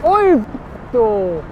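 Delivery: high, drawn-out exclamation; startled curse. Real, not acted. Reacting to a skating mishap, a high drawn-out curse. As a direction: swear out loud in sudden alarm, stretching it.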